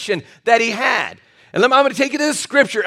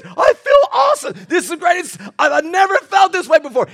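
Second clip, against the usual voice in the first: overjoyed; 8 semitones higher than usual. Retold or imitated. Big guy jumping up saved, much higher excited shout. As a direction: burst with new-found joy. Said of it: "He said".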